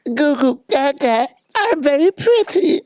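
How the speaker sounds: put-on high breathy baby voice; Marilyn Monroe voice. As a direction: coo like a baby-voiced starlet. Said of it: "Marilyn Monroe was always like".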